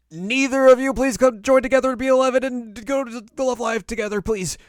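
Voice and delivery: very high, loud hammed-up plea; over-the-top imitation of a desperate appeal; mock pleading announcement. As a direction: beg them in a loud, squeaky rush of feeling. Announcer's twist becomes crowd's plea.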